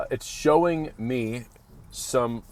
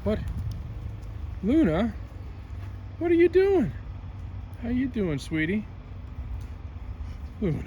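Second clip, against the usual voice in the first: squeaky praising a dog; 11 semitones higher, 7 dB quieter than usual. Real, not acted. Calling the dog.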